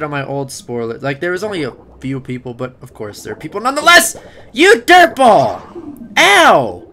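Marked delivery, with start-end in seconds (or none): 4.5-6.9 high loud pained cries; panicked yelling. Pretend. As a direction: yell as he gets hit. Attacked while talking.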